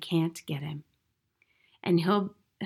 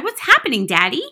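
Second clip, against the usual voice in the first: put-on high, loud alarmed child; cried out; 4 semitones higher, 9 dB louder than usual. A narrator's reading. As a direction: cry out to Daddy, bewildered. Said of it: "she cried".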